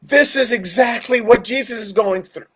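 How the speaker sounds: high-pitched acted amazement; astonished realization. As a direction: voice someone suddenly putting it all together. Acting people who suddenly realize.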